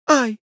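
high yelp of alarm; short high-pitched feminine "ai"; lady's cry. A narrator's reading. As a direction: cry out high, without much volume. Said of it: "закричала дама".

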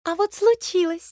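high little bird voice; lively small-bird chirp; perky chirping titmouse. Acted. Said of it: "чиликнула бойкая синичка".

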